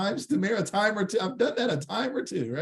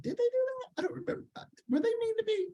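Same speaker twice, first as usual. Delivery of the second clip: very high, small squeaky voice; hammed-up puzzled falsetto; mock innocent bewilderment; 5 dB quieter, faster than usual. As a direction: play clueless in a tiny high pitch. Acts out forgetting others' wrongs.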